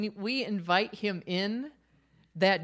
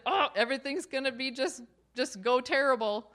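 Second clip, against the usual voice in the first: mock dread; 6 semitones higher than usual. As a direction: rattle it off like a scared sceptic. Mocking fearful expectation of God.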